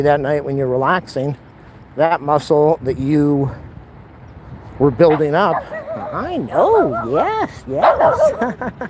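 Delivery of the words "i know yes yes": higher and higher pet talk; climbing pitch, doting dog voice; 1 cooing at a dog. Real, not acted.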